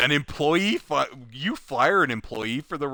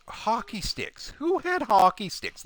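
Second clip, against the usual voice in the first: slow, higher puzzled hockey sticks; bewildered question; mock confused; 5 semitones higher, 4 dB quieter than usual. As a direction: sound baffled and searching. Confused at recognition.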